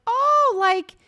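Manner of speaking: high, loud mock-casual babble; evasive excuse. Halmoni caught coming out of the house, hammed-up evasive. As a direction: stretch it out high, stalling for an excuse.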